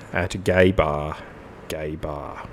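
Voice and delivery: low, soft, fading refrain; deadpan refrain. Acted. Host closing the spoken lyrics, fading deadpan.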